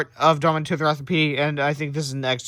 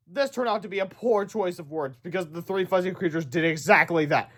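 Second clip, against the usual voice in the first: ordinary delivery, nothing marked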